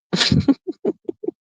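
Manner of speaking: light, high chuckle; soft real giggle; laugh. Real, not acted. Streamer reading the chat, light amused laugh.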